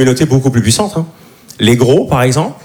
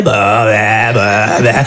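annoyed ugh; 6 semitones lower, much slower than usual. Retold or imitated. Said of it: "on s'est regardé".

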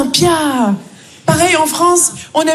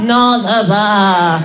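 slow, lower, glum answer; gloomy coworker; 5 semitones lower, much slower than usual. Retold or imitated. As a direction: drag it out, dejected. Martine, déprimée. (Martine au bureau, morose et lente)

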